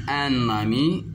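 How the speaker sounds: ordinary talk, nothing marked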